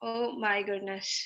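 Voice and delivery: soft, drawn-out exasperated sigh. Real, not acted. Teacher sighing at impatient students, drawn-out and soft.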